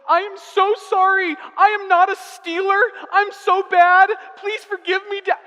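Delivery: high, loud, hammed-up child sobbing sorry; imitating tearful child. Daughter begs dad's forgiveness, mock-tearful and loud. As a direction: play a wailing five-year-old begging. Child apologizes in crocodile tears.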